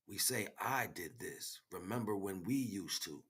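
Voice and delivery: usual speaking voice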